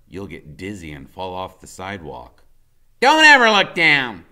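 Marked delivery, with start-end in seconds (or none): 3.0-4.3 put-on high, loud boyish command; a small boy bossing his friend, much higher; Freak's voice. A narrator's reading. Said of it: "he says".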